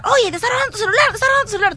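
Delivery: high-pitched acted cry of alarm; retold shouting. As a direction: imitate someone urgently calling out a theft. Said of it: "de pronto empiezan a gritar".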